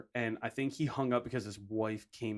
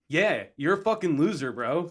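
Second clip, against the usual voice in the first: loud, higher-pitched taunt; retold jeer; 5 semitones higher, 7 dB louder than usual. Heckling a caller on Skype, retold jeer. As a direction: rub it in with a punchy jeer.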